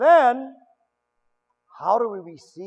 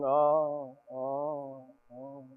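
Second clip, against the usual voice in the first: mock moans fading softer and softer; imitating widow's moaning; 4 semitones lower, 8 dB quieter, much slower than usual. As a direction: moan like a widow at a wake. Mourning widow at a wake.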